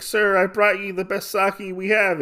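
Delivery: high, put-on servant voice; imitated waitress. Waitress bringing the best sake, high imitated voice. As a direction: speak up high like an obliging server.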